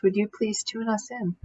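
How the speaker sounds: usual speaking voice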